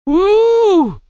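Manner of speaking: long, very high "woo"; sustained high-pitched cry of "woo"; high whoop. Real, not acted. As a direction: let out a held falsetto whoop. Sudden whoop on stream.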